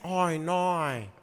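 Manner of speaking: drawn-out broad Australian vowels; Australian accent. Shown as a demonstration. Adding the accent for co-host, drawn-out Australian. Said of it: "then you do the accent".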